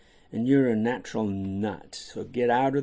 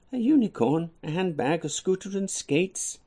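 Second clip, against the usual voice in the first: put-on excited child wish list; 7 semitones higher than usual. Child lists wished gifts.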